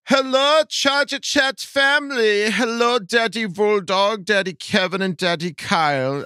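put-on high, leering German-accented falsetto; campy, high-pitched German creep; German pervert. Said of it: "the German perverted voice".

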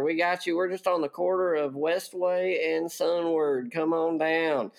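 folksy mock commercial voice, pitched high; hammed-up country radio ad. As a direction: sell the Zord repair shop like a small-town TV ad. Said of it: "Apparently, I can make commercials".

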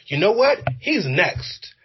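high-pitched, sure he's next; excited prediction. Retold or imitated. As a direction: announce it with eager certainty. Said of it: "you're like".

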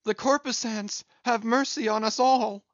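high, loud plea of wonder; awed cry. A narrator's reading. Stubb crying out in awe at the mast fires. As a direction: cry to the lights for mercy. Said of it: "immediately shifting his tone, he cried".

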